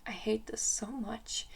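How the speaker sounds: hushed despairing whisper. Retold or imitated. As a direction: breathe it out, utterly drained. Quoting herself after bad dance.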